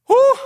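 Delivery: sharp high whew of effort; exertion exhale. Real, not acted. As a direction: blow out hard after the set. Exhale during hard workout.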